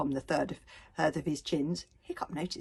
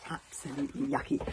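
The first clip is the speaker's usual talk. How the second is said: ordinary delivery, nothing marked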